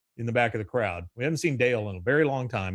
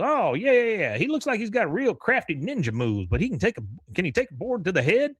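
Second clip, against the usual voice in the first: higher, fast brash boss voice; mock Buck Strickland; 8 semitones higher than usual. Boss calling Hank about betting on a fight, high and fast. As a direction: rattle it off like a pushy boss.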